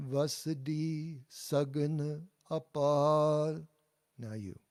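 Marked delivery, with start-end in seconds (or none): 0.1-3.7 Vasadi Sagan Apar slowly, louder, short A in Sagan and long A in Apar; slow, clear call for the class to repeat; slow model phrase. Shown as a demonstration. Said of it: "I'll say it, then you say it".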